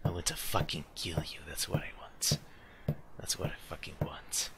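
low, soft threatening whisper; creepy psycho whisper; menacing whisper. Pretend. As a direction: hiss the threat like a killer. Menacing whisper to game enemy.

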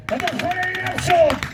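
high, urgent battlefield yell; exasperated shout to advance; shouted order. A narrator's reading. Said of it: "ktoś krzyczał".

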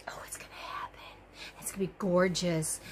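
hushed excited whisper. Real, not acted. Crocheter whispering to viewers about her gorgeous blanket, a hush. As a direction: breathe it like a secret you can't keep.

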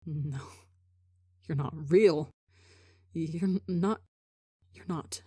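despairing murmur. Acted. Rambu spiraling, self-loathing.

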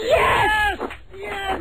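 high, delighted shout of yes; overjoyed game host cheering. Real, not acted. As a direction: cheer like he won the prize. Host celebrates correct answer.

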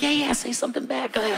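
high, soft goading wife; imitating wife. Wife daring husband to answer back, high soft goading. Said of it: "She looked at me like".

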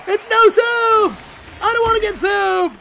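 high, loud, exasperated Seinfeld shout; agitated Seinfeld. Shown as a demonstration. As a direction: yell it like Jerry losing it on the show. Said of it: "agitated and in the show".

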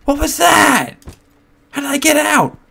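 high shrieking panic; terrified screaming. Real, not acted. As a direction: shriek it in disbelief. Waking in shock.

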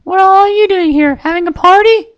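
very high, bright cartoon pony voice; high squeaky cheerful pony girl; Pinkie Pie voice. A narrator's reading. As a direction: chirp it like a giddy little pony.

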